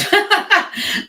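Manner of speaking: a burst of real laughter; bright laugh. Real, not acted. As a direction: laugh out loud at yourself. Laughing at own mistakes.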